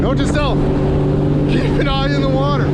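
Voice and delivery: high, loud startled outburst. Real, not acted. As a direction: blurt it out after a near miss. Nearly hits a boulder.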